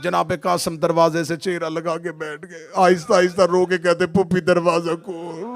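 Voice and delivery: very loud, high wailing preaching over music; wailing sermon. Acted. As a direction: wail the tragedy to the crowd at full force. Majlis wailing at full force.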